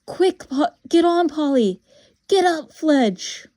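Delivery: loud, high-pitched urgent shouting. Acted. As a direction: shout orders to companions while being chased. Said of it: "was shouting out".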